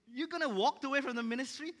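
high, loud incredulous question; shocked disbelief. Retold or imitated. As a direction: blurt it out in shock, pitch jumping up. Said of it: "I didn't say that out loud".

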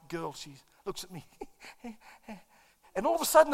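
ordinary delivery

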